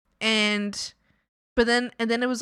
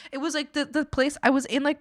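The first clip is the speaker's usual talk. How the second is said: ordinary delivery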